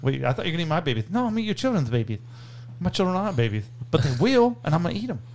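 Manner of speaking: lisping boxer impression; mock threats in a childlike boxer voice; Mike Tyson. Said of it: "That's another Mike Tyson quote".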